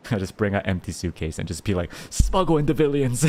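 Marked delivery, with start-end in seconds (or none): 2.1-3.3 higher, louder playful outburst; gleeful mock scheme. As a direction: play it up like a silly smuggler. Gleefully acting out smuggling plushies.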